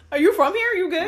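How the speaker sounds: confrontational challenge. Retold or imitated. Challenging a stranger on the block.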